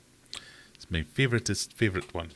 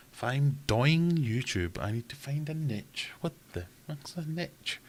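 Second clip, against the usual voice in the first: baffled parody of his advice; mock confusion; 8 semitones higher, slower than usual. Mocking the YouTuber's DOY-ING and NITCH, baffled. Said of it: "And I'm going".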